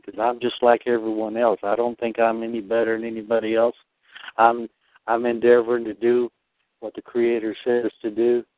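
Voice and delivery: normal talking voice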